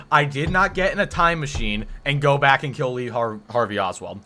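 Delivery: ordinary talk, nothing marked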